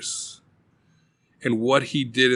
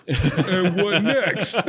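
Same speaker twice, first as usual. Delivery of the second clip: mock detective; 8 semitones higher, much slower than usual. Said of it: "Detective said".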